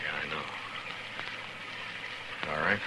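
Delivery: hushed. Acted. Pinned-down marshal and deputy plan a rush, hushed and low. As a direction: keep it down so he can't hear.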